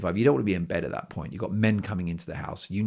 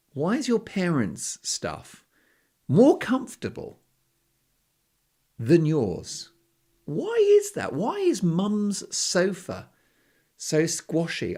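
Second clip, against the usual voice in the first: mock bafflement; 9 semitones higher, slower than usual. Real, not acted. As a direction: pitch climbing higher and higher in mock disbelief. Baffled rhetorical questions about sofas.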